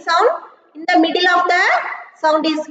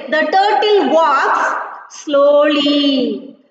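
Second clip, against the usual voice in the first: slow emphatic reading; 3 semitones lower, much slower than usual. Shown as a demonstration. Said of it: "Yeah, children, listen".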